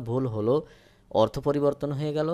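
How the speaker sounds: ordinary delivery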